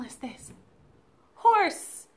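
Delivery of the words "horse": excited. Shown as a demonstration. Naming the horse excitedly.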